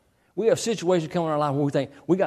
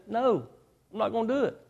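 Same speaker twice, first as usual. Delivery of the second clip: higher, slightly hushed refusal; lightly voiced stubborn king, pitched up; defiant Pharaoh; 6 semitones higher, 5 dB quieter than usual. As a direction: play a mild, high-pitched royal no. Pharaoh refuses God's demand.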